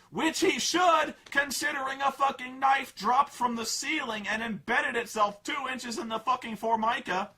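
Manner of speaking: high, loud outrage; exasperated rant. Real, not acted. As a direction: let the outrage spill. Ranting frustration.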